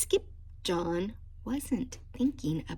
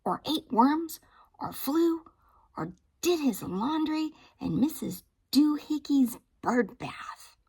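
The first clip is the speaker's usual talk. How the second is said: higher, louder scolding mother; 6 semitones higher, 5 dB louder than usual. Acted. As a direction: scold the kitten firmly. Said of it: "she scolded".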